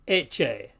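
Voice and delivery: ecce as "etch-ay"; CC as TCH. Shown as a demonstration. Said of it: "more like a T C H".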